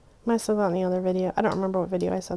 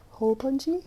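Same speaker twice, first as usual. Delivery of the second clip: put-on higher, cutesy baby talk; toddler talk; 5 semitones higher than usual. Shown as a demonstration. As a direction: say it like a little kid. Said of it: "talking like a two-year-old".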